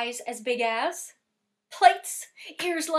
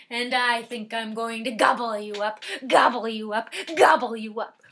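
gobble you up growing louder each time; greedy troll; 7 dB louder than usual. Acted. Troll to the billy goat, louder with each gobble. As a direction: build the threat, gobbling louder and louder.

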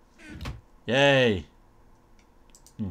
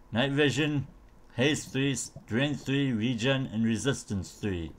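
ordinary talk

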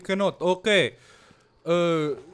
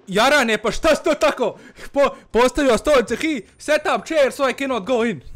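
raised, higher voice at an absent buddy; loud complaint; 5 semitones higher, 4 dB louder than usual. Real, not acted. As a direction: gripe loudly at whoever blocked the way. Complaining loudly to absent friend.

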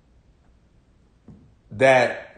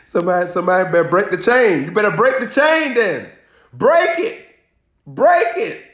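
preaching shout; 5 semitones higher, 4 dB louder than usual. Acted. Preacher exhorting the crowd.

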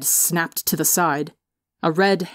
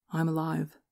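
much softer, hushed; whispered; 9 dB quieter than usual. A narrator's reading. Brother reassures Tommy in a hushed whisper. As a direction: breathe it gently to his brother. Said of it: "he whispered".

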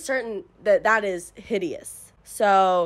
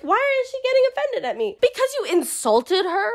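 1 incredulous; 11 semitones higher than usual. Real, not acted. Incredulous at the character.

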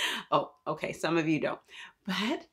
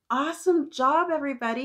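high, louder cheer; big praise; 8 semitones higher, 5 dB louder, faster than usual. Real, not acted. Teacher praising children for getting ready to listen, high loud cheer.

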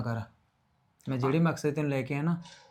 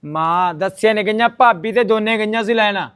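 loud, slow, emphatic shout; shouted correction; 5 semitones higher, 9 dB louder, slower than usual. Acted. Son shouting over his mother to correct her, loud and slow. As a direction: hammer the correction home.

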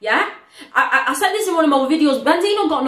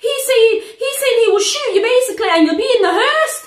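pitched up high and rushed; excited reaction; 7 semitones higher than usual. Real, not acted. Vlogger hyped over Obi's verse, excited. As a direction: hype about the verse, fast and high.

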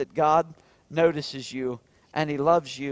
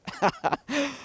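fond laugh; 9 semitones higher than usual. Real, not acted. Pastor laughs at memory.

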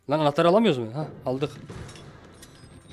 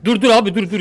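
loud, high, repeated plea; alarmed pleading; 7 semitones higher, 9 dB louder than usual. Real, not acted. Player begging the attacker to stop, alarmed shout. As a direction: beg it loudly to stop.

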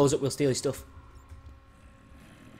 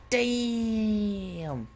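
high, long falling wail; long-drawn damn; 6 semitones higher than usual. Real, not acted. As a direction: start high and slide slowly down. Exaggerated long exclamation repeated.

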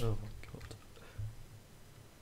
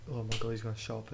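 ordinary delivery

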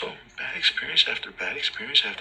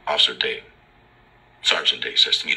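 usual speaking voice

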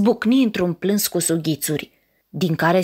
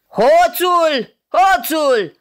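higher, louder furious yelling; ogre shouting thief; 9 semitones higher, 5 dB louder, much slower than usual. Acted. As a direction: bellow after the thief running off. (căpcăunul strigă furios după hoț)

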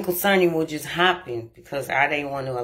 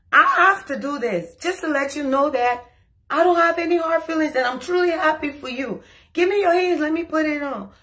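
high, over-sweet cheer; exaggerated cheer; 9 semitones higher than usual. Handing her friend the ex's ring, high and over-sweet. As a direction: lay the happiness on thick.

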